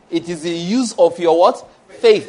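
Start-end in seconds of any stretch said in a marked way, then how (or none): 1.9-2.3 "Faith" punched high and loud; loud, raised stress on "Faith"; emphatic. Real, not acted. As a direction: hit "Faith" hard as the key word. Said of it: "That's my emphasis".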